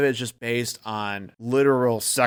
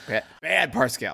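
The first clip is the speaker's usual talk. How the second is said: normal talking voice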